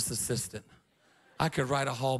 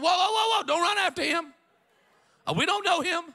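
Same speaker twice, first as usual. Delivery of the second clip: loud high call of whoa, whoa; urgent shouted warning; shouting to stop him; 14 semitones higher, 5 dB louder than usual. Retold or imitated. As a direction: yell at him to stop running after the thief. Calling out to man chasing thief.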